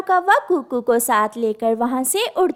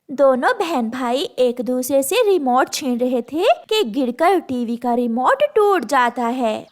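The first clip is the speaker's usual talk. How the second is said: plain reading voice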